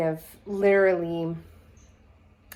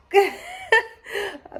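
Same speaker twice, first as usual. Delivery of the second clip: embarrassed high laugh; 12 semitones higher, 3 dB louder than usual. Real, not acted. Embarrassed after spoiler apology.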